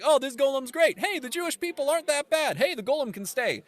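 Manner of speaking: high mock-delighted voice; mock won-over crowd. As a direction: gush up high like a sudden convert. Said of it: "So everyone's like".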